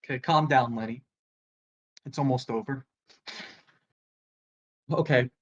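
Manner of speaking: low, soft self-soothing; steady yourself under your breath, low; hushed and low, calming down; talking himself down. Acted. Comedian steadying himself on stage.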